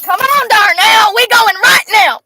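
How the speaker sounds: high, loud, slow mock shout of an angry woman; mock yell. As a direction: yell it like the fed-up girlfriend. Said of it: "She said".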